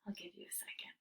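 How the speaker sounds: soft aside whisper; teacher whispering to the class as they try. Real, not acted. Teacher pauses for students.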